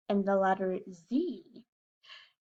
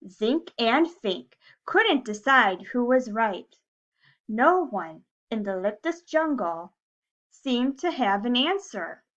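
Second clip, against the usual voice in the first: lively, louder storybook reading; reading to children; 2 semitones higher, 6 dB louder, slower than usual. A narrator's reading. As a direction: read it like story time for little kids. Reading a picture book to class.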